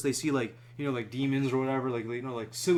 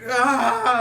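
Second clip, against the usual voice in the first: loud high scream of "ah" like a maniac; night-terror scream; 8 semitones higher, 10 dB louder than usual. Retold or imitated. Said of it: "I was screaming in the middle of the night like a maniac".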